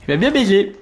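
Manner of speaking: high, loud outburst; frustrated yell. Real, not acted. Frustrated exclamation.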